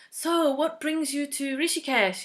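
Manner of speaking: dental teacher. Retold or imitated. Dental teacher quizzing patient.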